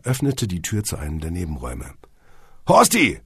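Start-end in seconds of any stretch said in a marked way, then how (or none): 2.7-3.2 high, loud call. A narrator's reading. Elephant calling into the side room, shouting. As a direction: holler for a colleague. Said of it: "rief er".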